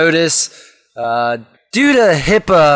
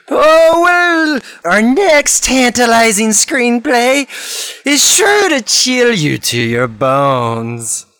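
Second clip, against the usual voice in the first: slow, spooky showman introducing the next tale; jack o lantern voice; 5 semitones higher, much slower than usual. Acted. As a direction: draw it out like a Halloween host.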